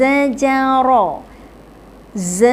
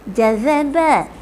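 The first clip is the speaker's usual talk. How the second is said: slow. Shown as a demonstration. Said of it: "Satu-satu".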